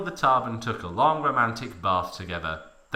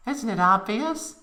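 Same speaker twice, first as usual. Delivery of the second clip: giggly Aeris; 11 semitones higher than usual. A narrator's reading. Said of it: "she giggled".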